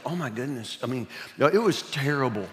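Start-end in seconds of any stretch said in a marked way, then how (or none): 0.1-0.8 hushed, frightened gasp of words; scared kid. Retold or imitated. Boy terrified by the yelling teacher, hushed. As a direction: say it under your breath in fear. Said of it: "I'm just like".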